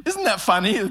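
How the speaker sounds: normal talking voice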